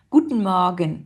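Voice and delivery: "Guten Morgen" with the E's left unpronounced; silent E's. Shown as a demonstration. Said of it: "on les prononce pas".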